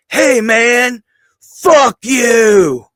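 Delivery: high, loud mock yell; retold hostile shout. Wrestler imitating a kid who ran up to him, shouting.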